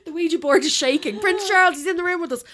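high, loud, playful alarm; mock séance excitement. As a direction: cry out as if a spirit arrived, jokingly. Joking about summoning the prince.

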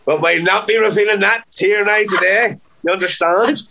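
high-pitched Traveller character, playfully refusing. Pretend. Guest playfully guarding a secret from the interviewer, high Traveller character voice.

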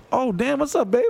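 high, flirty mock coo.

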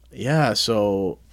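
ordinary delivery

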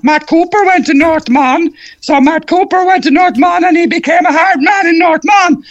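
very high, strained shouting caricature; North Mon character. Acted. Comedian doing Cork characters by school, North Mon voice very high and strained. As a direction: shriek it like a cartoon Cork schoolboy.

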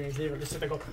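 ordinary delivery

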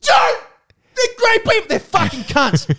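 high, loud rant easing slightly at the end; outraged shouting. Real, not acted. Podcaster defends the Kiwis then insults them, outraged shouting. Same outburst, turning on the Kiwis.

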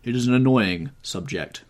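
emphatic interruption; breaking in. A narrator's reading. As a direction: cut in over the other speaker. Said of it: "broke in Lord Henry".

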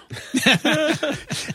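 long high laugh at himself; real amused laughter. Real, not acted. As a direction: burst out laughing. Laughs at own story.

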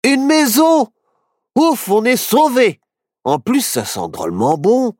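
high, loud relief then glad; delighted. Acted. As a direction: rejoice at the house. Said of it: "se réjouit Barnabé". (Barnabé soulagé et ravi)

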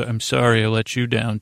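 normal talking voice

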